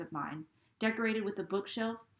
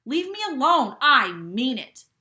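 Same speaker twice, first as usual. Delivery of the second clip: much louder, last words slowed; shouting; 5 semitones higher, 13 dB louder than usual. Acted. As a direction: yell it at him, then lean on each word. Boy threatened with a gun.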